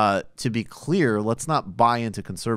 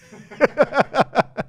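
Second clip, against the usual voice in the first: big laugh; 7 dB louder than usual. Real, not acted. Laughing at a horrible-idea tweet.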